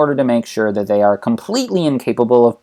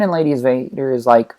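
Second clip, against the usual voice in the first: ordinary talk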